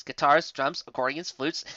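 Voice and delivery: ordinary delivery, nothing marked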